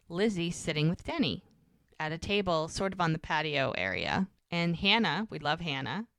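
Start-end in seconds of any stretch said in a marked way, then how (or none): none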